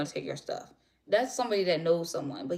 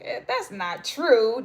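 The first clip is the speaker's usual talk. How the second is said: doubtful scoff; 5 semitones higher, 8 dB louder, much slower than usual. Retold or imitated. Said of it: "I'm like".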